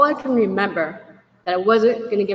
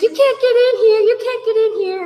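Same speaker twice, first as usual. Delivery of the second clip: put-on girlfriend blocking door; 13 semitones higher, 6 dB louder than usual.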